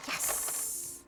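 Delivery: hushed, whispered little victory; whispered yes. Real, not acted. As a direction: whisper it with a drawn-out hiss. Gets the decoration.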